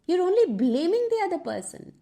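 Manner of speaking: ordinary delivery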